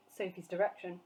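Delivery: usual speaking voice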